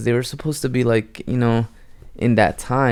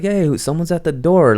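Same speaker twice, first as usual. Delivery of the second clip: mock calling out; 6 semitones higher than usual. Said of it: "she's calling for her brother".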